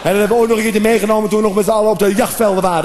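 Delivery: ordinary delivery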